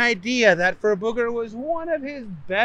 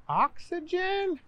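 high puzzled voice; 12 semitones higher, 5 dB quieter, slower than usual. Acted. Booger repeats, puzzled.

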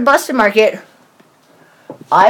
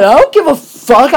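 put-on drunk date; 5 semitones higher, 5 dB louder than usual. Drunk date retorting in line.

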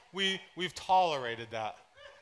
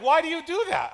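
incredulous wife; 12 semitones higher, 8 dB louder than usual. Retold or imitated. Wife questioning sock habit.

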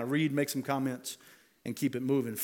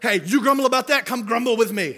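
higher, louder chummy call to grumble; mock inviting grumbler; 4 semitones higher, 5 dB louder than usual. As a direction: playfully voice a griper recruiting friends. Mock quote of grumbling people.

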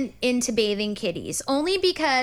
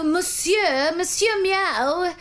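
Monsieur Meow in a higher, slow, mock French accent; 6 semitones higher, much slower than usual. Slow, hammed-up French accent. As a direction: owner names her cat in his French accent, playful. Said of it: "He's in his French accent".

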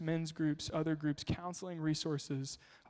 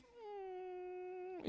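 whimper; 12 dB quieter than usual. Retold or imitated. Toddler about to cry.

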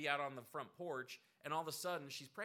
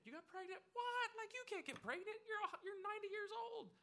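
very high, squeaky disbelief; high falsetto gasp of disbelief; shocked friend. Retold or imitated. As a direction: shriek it like a stunned girlfriend.

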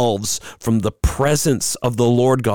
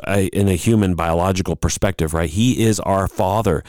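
normal talking voice

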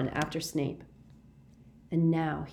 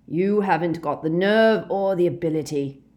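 loud, disdainful taunt in character; scornful shout; 5 dB louder than usual. A narrator's reading. Snape taunting Harry over the flames, shouting. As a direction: taunt him with a raised voice. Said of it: "he shouted over the rushing of the flames".

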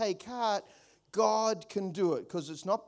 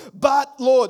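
loud shout of "Lord"; shouted plea; 3 semitones higher, 11 dB louder than usual. Pretend. Gideon objecting to God, shouted plea. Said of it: "here's Gideon".

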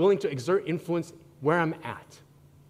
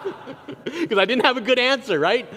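much higher, louder, drawn-out protest; exasperated confession; 9 semitones higher, 5 dB louder, slower than usual. Real, not acted. Pastor confessing to laughing congregation, high and loud protest. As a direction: pitch it way up and plead your case.

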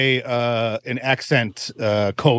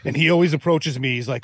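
normal talking voice

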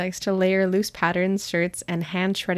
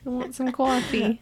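put-on New York accent, laughing; 5 semitones higher than usual. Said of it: "they say coffee like".

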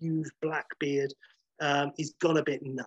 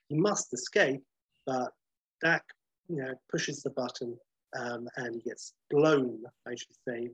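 ordinary talk

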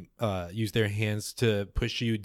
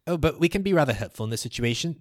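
put-on higher, hurried old advisor; fussy elder's eager protest; protesting elders; 6 semitones higher, faster than usual. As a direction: plead quickly to be allowed to stay. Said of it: "the three turn around and look at him and say".

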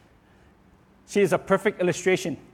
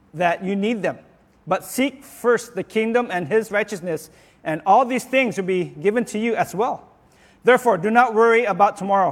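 scripture reading; 2 semitones higher, 4 dB louder than usual. Acted. Preacher reads Matthew to the church, proclaiming. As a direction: proclaim each line of the verse.

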